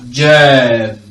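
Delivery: GERM pushed loud, with a held "air" vowel; emphatic long vowel. Shown as a demonstration. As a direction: lean hard on germ and stretch it. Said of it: "stress and place emphasis".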